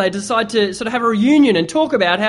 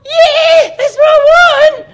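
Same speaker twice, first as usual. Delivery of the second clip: squeaky voice; 10 dB louder than usual. Acted. Third guy insists in squeaky voice.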